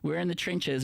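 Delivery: normal talking voice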